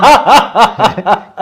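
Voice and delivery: short real laugh; amused laugh at the stuttering student. Real, not acted. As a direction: burst out laughing at him. Laughing at the stumble.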